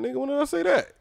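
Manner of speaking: high, indignant retort; retold protest. Host disputing a friend's claim, indignant protest. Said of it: "I'm like".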